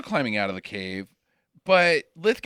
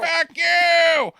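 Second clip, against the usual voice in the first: much higher, louder shouted jab; mock shout; 11 semitones higher, 4 dB louder than usual. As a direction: yell it to top the last one. Topping the echo with a shout.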